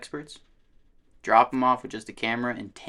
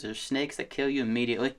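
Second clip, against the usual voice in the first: normal talking voice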